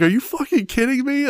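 high-pitched retold disbelief; mock incredulous. Husband replays his reaction to wife's fart, mock incredulous. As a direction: question her in high, amused disbelief.

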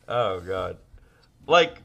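ordinary talk

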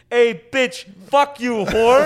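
loud, high mock yell at a passer-by; retold yell out the window. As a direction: bark the insults out the car window. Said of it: "yells out the window".

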